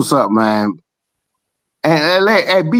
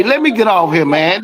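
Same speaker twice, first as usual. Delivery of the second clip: ordinary delivery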